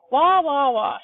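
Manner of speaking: put-on loud, high baby cries; old woman turned into a wailing baby; baby bawling. A narrator's reading. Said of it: "bawling her head off".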